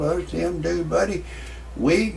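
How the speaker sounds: ordinary delivery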